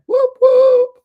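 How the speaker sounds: loud, very high double whoop; celebratory whoop. Real, not acted. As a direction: cheer with two high hoots. Cheering for Scorpio on livestream.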